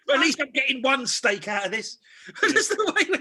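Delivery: high, loud laughing quote. Retold or imitated. Scammer consoling himself over one steak, retold through laughter. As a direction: push the words out through laughter.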